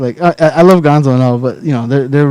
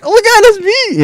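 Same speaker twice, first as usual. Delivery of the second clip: much higher, slow disbelieving squeal; mock shock. Cohost recognizing her own voice, high mock shock. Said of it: "You're like".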